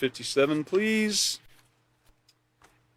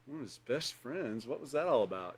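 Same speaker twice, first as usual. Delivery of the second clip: much softer, puzzled murmur to yourself; doubtful mutter; 14 dB quieter than usual. Retold or imitated. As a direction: wonder quietly what that meant. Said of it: "You'd probably go away wondering".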